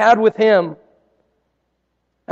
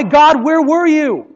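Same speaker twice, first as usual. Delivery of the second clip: slow, higher, louder cry to God; anguished cry; 6 semitones higher, 4 dB louder, slower than usual. Retold or imitated. Angry cry at God, retold.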